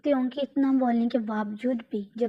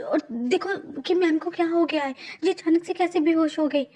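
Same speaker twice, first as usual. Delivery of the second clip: higher, louder alarm; panicked friend; 7 semitones higher, 4 dB louder than usual. Acted. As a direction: shout for the doctors in a panic. Friend panics as she faints.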